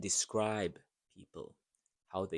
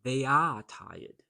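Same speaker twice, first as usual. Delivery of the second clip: "are" slowed and louder, x0.7 pace; stressed are; 5 dB louder than usual. Shown as a demonstration. Teacher drilling the correct form for students, stressing are. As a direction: lean on "are" so learners hear it.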